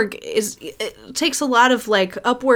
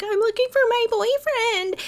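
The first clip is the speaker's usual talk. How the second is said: imitating young woman; 12 semitones higher, 4 dB quieter than usual. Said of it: "And she's like".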